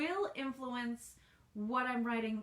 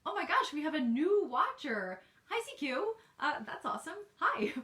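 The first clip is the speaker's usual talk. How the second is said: high, excited live-stream welcome; delighted surprise; 6 semitones higher, 5 dB louder than usual. Real, not acted. Host spotting a new watcher, delighted surprise. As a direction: greet a newcomer with a high, happy burst.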